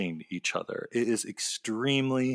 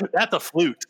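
mock fan voice; 6 semitones higher, 4 dB louder than usual. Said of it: "It's like".

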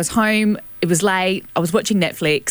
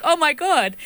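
higher-pitched mock alarm; retold fright; 7 semitones higher, slower than usual. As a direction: act out a startled brother.

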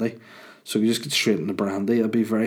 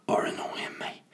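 whisper; 7 dB quieter than usual. Real, not acted. Whispering to listeners about annoying people. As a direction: drop to a confiding whisper. Confiding gripe, voice drops.

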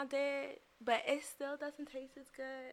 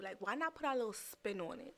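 ordinary talk, nothing marked

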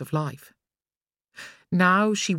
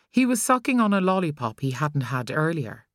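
ordinary delivery, nothing marked

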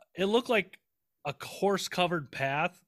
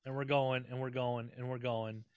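fading repetition; 3 semitones lower, 8 dB quieter than usual. Acted. Long ride, repeated fading.

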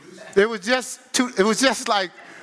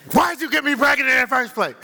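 laughing, higher mock complaint at the husband; mock labouring wife; 6 semitones higher, faster than usual. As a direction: play the exasperated wife mid-labour, half laughing. Woman in labour blaming husband.

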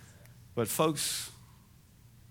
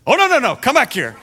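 much higher, loud laughing protest; wife calling her husband back; calling him back; 10 semitones higher, 12 dB louder, faster than usual. Retold or imitated. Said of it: "my wife says".

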